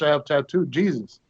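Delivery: ordinary talk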